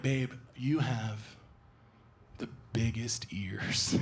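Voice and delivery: slow whisper; slow mock girlfriend whispering a backhanded compliment; imitating girlfriend whisper. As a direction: breathe it slowly, close. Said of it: "Then she'd be like".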